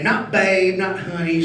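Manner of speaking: ordinary talk, nothing marked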